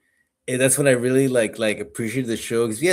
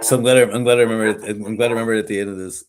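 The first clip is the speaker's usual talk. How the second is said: rushed; 4 dB louder, much faster than usual. Real, not acted.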